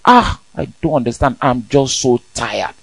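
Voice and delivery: mock a tired morning groan; weary complaint. Person waking up, moaning about being tired. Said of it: "the first thing you say is".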